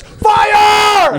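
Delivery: very high, full-throated mock alarm yell; off-mic shout. Imagined eight-year-old's dad yelling out a false alarm, comic high shout. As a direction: yell it across the room. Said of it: "I went off mic for that".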